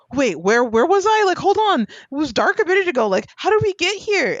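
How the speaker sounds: put-on a skit kid popping into existence, high and bewildered; confused kid. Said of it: "he's just like".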